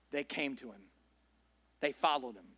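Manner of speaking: hushed payoff. Real, not acted. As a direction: drop low and soft on the answer. Quiet dramatic payoff.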